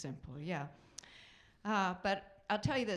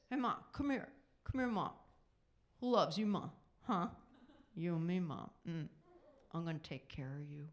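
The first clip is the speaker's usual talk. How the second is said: affectionate son; 3 semitones lower than usual. Retold or imitated. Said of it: "they'll do this".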